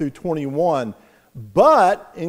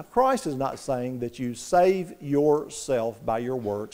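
normal talking voice

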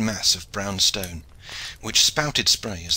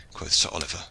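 ordinary delivery, nothing marked

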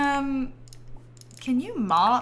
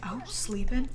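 whisper; 6 dB quieter, slower than usual. Real, not acted. Baby asleep, hushed.

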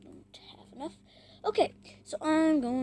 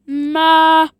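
too close to mic; 4 semitones higher, 18 dB louder than usual. Real, not acted. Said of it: "I'm trying to speak in the microphone".

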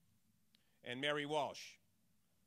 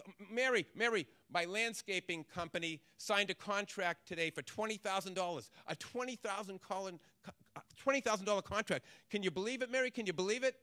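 higher, louder, tumbling out with joy; voicing a thrilled young man, a little faster; excited; 4 semitones higher, 5 dB louder than usual. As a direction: burst with news you can't hold in. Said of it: "he was really excited".

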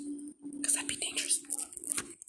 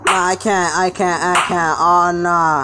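raised, loud protest of alarm; alarmed outcry; 3 semitones higher, 6 dB louder, slower than usual. Real, not acted. Player being caught up by Baldi, alarmed outcry. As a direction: cry out in alarm, refusing to go on.

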